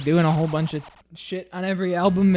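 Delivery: ordinary talk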